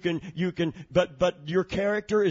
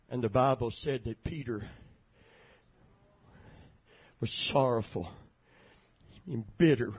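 hushed sorrow; 4 semitones lower than usual. A narrator's reading. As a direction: drop it down for Peter's grief. Quiet sorrowful retelling after preaching.

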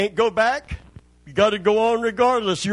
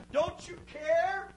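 higher, much softer, slower frightened plea; 7 semitones higher, 11 dB quieter, slower than usual. Acted. Disciples in the sinking boat asking Jesus, in a soft scared higher plea. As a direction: let it shrink to a small scared cry.

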